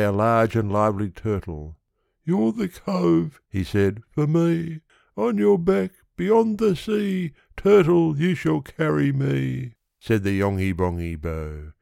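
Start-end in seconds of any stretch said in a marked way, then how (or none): 2.2-3.4 high, drawn-out character voice; Yonghy Bonghy Bò. Acted. Suitor hails the turtle. 4.1-9.7 high-pitched little character, urging; Yonghy Bonghy Bò. Acted.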